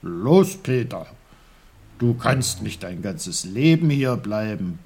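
loud, stern wake-up call; firm, no-nonsense urging, hit hard at the start. A narrator's reading. Uncle wakes Peter from his long sleep, stern. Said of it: "eine strenge Stimme".

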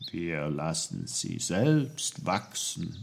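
low, softened tree voice; rustling birch. A narrator's reading. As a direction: speak low and softly, like rustling leaves. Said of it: "rauschte die Birke".

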